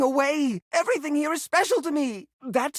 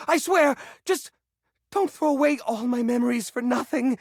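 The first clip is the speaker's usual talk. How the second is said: ordinary talk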